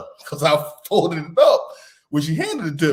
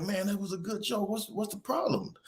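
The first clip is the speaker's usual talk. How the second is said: higher and softer, puzzled appeal; coaxing dad; 8 semitones higher, 9 dB quieter than usual. Retold or imitated. Dad coaxes upset daughter after the prank, soft and puzzled. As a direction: imitate a dad gently asking what's wrong.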